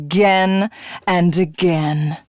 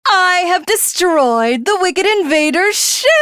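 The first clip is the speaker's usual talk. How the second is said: triumphant; 8 semitones higher than usual. Acted. Villain celebrates destroyed ship.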